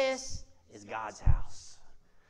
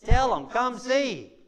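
emphatic preaching; 4 semitones higher, 9 dB louder, much slower than usual. Real, not acted. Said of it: "What do you have to do?".

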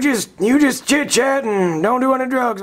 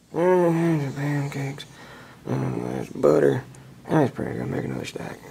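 dreamy mutter; 11 semitones lower, 7 dB quieter than usual. Acted. Teacher drooling over breakfast.

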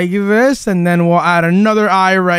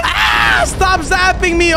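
pained yelp; 8 semitones higher, slower than usual. Acted. Being zapped by fangirl.

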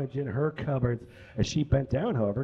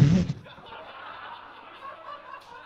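a burst of high giggling; high squealing laugh. Real, not acted. Laugh between lines.

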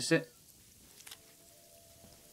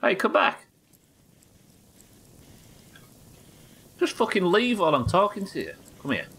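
annoyed calling out; 10 semitones higher than usual. Real, not acted. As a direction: call them back, irritated. NPC walks away mid-talk.

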